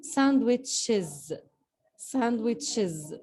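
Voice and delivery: "sandwiches" drawn out, ending ɪz clear; slow model word. Shown as a demonstration. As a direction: say it very slowly for learners. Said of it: "the E S ... pronounced like this".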